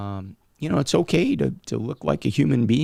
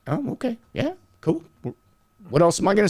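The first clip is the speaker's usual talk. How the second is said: higher, breezy mock coach; lightly voiced coach, pitched up; mock coach reply; 8 semitones higher, faster than usual. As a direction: play the coach shrugging it off, pitched up. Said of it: "they're not gonna, like".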